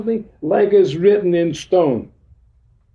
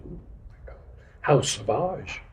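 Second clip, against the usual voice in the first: mock shocked whisper; 3 semitones lower, 9 dB quieter than usual. Mock shocked reaction to honesty.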